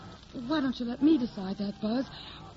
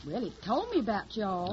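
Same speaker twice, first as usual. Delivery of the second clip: put-on hillbilly accent; 2 semitones lower than usual. Hildy poses as a Tennessee country girl, in a put-on Southern accent. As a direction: play the naive Southern hillbilly singer. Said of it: "I got the technique down pat".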